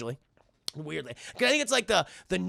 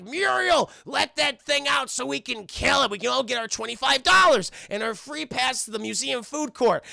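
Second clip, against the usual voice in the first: raised, loud cartoon calling out; demanding shout; 6 semitones higher, 4 dB louder than usual. Eustace with mob at front door, demanding shout. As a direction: holler demands at the house.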